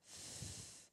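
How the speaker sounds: "f" as a soft, long stream of air; isolated phoneme. Shown as a demonstration. Teacher showing a rubbing gesture, soft "f" friction. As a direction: softly blow "f" with no voice and no vowel. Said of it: "ça donne une idée de frottement".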